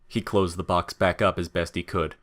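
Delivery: ordinary delivery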